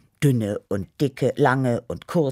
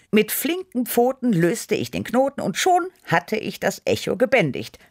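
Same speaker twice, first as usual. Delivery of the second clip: high, lively proclamation; proud announcement; 9 semitones higher than usual. A narrator's reading. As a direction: proclaim the heroic deed. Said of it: "verkündete ich". (verkündend, mit hoher Stimme)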